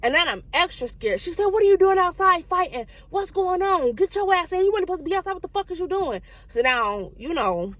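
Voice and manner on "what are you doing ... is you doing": high-pitched, rapid-fire mock scolding; mama scolding. Mama catching her child fighting outside, high mock scolding. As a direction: imitate an angry mother, pitched well up. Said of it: "rusty, dusty voice going on".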